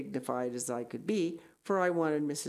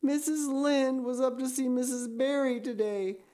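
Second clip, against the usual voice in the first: high, tearful lament; wailing; 8 semitones higher, 4 dB louder than usual. Acted. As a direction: wail out the bad news in despair. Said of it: "she wailed".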